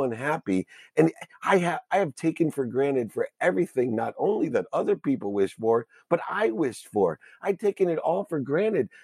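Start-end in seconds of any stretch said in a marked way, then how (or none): none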